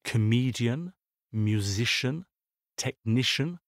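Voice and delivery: "comedian, musician, technician", each leaning on the syllable before the ending; stress before suffix. Shown as a demonstration. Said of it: "sont accentués sur l'avant-dernière syllabe".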